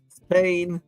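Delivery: ordinary talk, nothing marked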